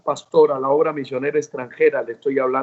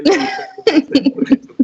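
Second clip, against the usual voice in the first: laughing; 11 semitones higher, 4 dB louder, faster than usual. Real, not acted. Laughs while preaching the story.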